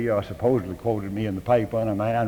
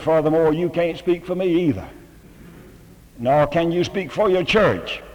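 raised and louder, pressing each word; firm rebuttal, higher and louder; emphatic retort; 7 semitones higher, 6 dB louder than usual. Retold or imitated. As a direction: hit back hard at the letter writer. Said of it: "And I said".

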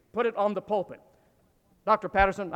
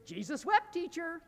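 put-on child reciting; 8 semitones higher, 8 dB quieter than usual. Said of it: "every time it came around".

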